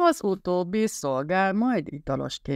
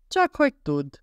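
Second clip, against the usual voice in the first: high, louder snappy comeback; retort; 11 semitones higher, 4 dB louder than usual. A narrator's reading. Cameron hitting back at Dorian, snappy retort. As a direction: fire it back at her. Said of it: "vágott vissza Cameron".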